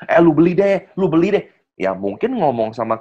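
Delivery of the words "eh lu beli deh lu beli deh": pushy hard sell. Retold or imitated. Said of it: "hard selling".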